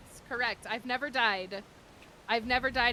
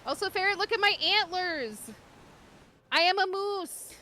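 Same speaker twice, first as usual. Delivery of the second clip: excited; 9 semitones higher, 3 dB louder than usual. Real, not acted. Showing off new antlers.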